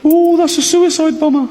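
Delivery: high jittery voice of paranoia; irrational inner voice. Retold or imitated. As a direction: squeak out the fear like a frightened gossip. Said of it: "this one goes".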